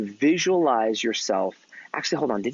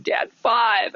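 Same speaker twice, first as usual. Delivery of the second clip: high upset outburst, retold; mock-dejected cry to a dad; upset kid; 9 semitones higher than usual. Boy reporting his poor score to dad, upset. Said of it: "I was so upset".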